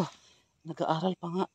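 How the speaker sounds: usual speaking voice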